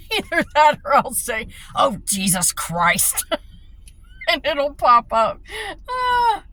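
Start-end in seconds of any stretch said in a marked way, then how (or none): 1.8-3.2 slow, drawn-out loud exasperation; exasperated shout. Retold or imitated. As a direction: groan it out loud, fed up. Retold exasperated exclamation.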